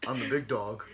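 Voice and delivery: low, soft tough-guy mumble; mock a wrestler's lifeless promo; understated boast, dropped down low; Roman Reigns. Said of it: "and of course he says".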